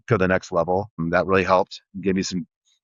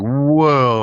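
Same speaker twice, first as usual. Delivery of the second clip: a louder, higher gasp of surprise; stunned at the sudden money; mock astonished whoa on seeing the sales; amazed whoa; 5 semitones higher, 5 dB louder than usual. First big sales morning.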